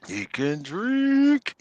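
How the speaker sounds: drawn-out high. Pretend. Echoing the joke, drawn out.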